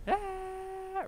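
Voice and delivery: high held "ah", mimicked; imitated reaction cry. Streamer acting out the soaked-in reaction, high held cry. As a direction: mimic a long, high-pitched gasp of reaction.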